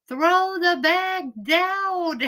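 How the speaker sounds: laughing mock yell, drawn out; retold scream. Parent shouting at daughter on the beach, laughing yell. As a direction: shout it slow through a laugh. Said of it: "and scream".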